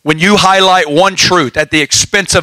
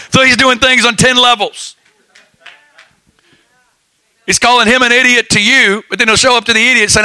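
raised higher, bubbling with laughter; excited laughing; 5 semitones higher than usual. Real, not acted. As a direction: explain it with amused delight.

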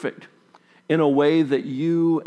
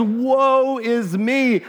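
slow, higher, grief-stricken woe; despairing lament; 9 semitones higher, much slower than usual. Retold or imitated. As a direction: let it drag out in dismay. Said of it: "It was".

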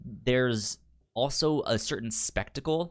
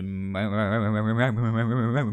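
comic stammer; 2 semitones lower than usual. Retold or imitated.

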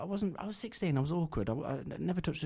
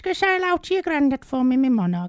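Missus Timmins impression; 13 dB louder than usual. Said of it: "Do go on".

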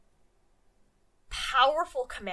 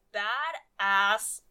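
slow emphatic punchline; 3 dB louder, much slower than usual. Acted. Punchline of thesis, drawn out.

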